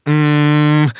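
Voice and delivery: loud hummed "mmm" of hesitation; drawn-out filler "mm". Shown as a demonstration. Showing a forbidden pause in a lie, long hummed "mm". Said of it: "or using".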